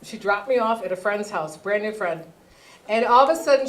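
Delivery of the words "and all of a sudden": usual speaking voice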